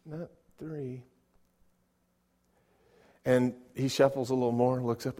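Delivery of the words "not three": very soft, slow, gentle no; gentle correction. Acted. Parent gently telling a son three is not enough, hushed. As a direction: correct a child softly, drawing it out. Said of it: "So gently you say".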